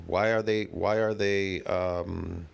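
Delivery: ordinary talk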